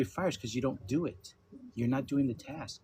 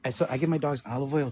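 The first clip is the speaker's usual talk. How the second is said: ordinary delivery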